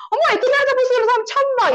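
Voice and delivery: very high, loud exclamation; high-pitched mimicry of a thrilled friend; imitated amazement. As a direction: gush in astonishment like the neighbour.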